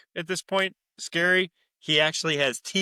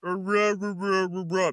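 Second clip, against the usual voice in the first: a hint of a higher child voice babbling rah rah; garbled girl babble; 5 semitones higher than usual. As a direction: mimic a child's speech heard as noise. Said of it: "all you hear her say is".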